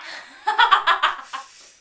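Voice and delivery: loud burst of laughter; laughing. Real, not acted. Laughing at own imitation.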